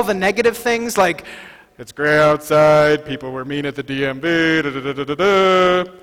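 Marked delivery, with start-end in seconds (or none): 1.8-5.9 glum complaining. Shown as a demonstration. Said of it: "And then I can say glibly".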